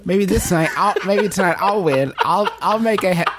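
high voice breaking into laughter; wishful pretend thoughts, giggling through them; laughing mock hope. Actor playing Caesar hoping to win tonight, laughing.

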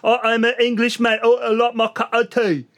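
exaggerated fake British, word endings dropped; bad British accent. Imitating overconfident American actors, terrible British accent. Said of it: "I can do a British accent".

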